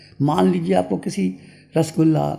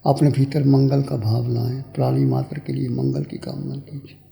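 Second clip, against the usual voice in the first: calm, spaced-out phrases settling into a low voice; guided meditation; 3 semitones lower than usual. Real, not acted. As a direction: guide them inward, letting phrases sink and fade.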